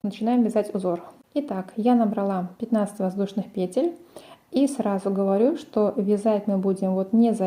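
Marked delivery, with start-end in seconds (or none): none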